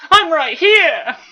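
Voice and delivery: very high loud shout, slow; first take shout. Acted. Bumblebee calling out to Megatron, high loud shout. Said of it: "say that a little bit better".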